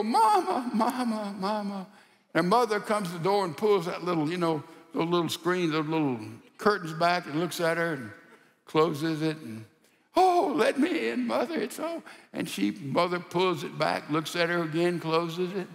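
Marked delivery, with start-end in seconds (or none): 0.0-1.9 a high cry of mama that fades to nearly nothing; daughter calling mama. Retold or imitated. Daughter banging on mother's door. 10.2-12.0 high, mock-tearful begging to be let in; pleading daughter.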